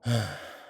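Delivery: one audible, falling sigh; heavy sigh. Acted. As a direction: sigh before a hard confession. Sighs before a painful confession.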